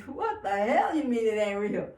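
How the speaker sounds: loud mock outrage; indignant disbelief. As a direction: push back hard, in disbelief. Acting out disbelieving pushback.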